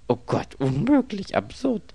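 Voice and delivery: high, incredulous outburst; exasperated disbelief. A narrator's reading. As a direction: throw up your hands.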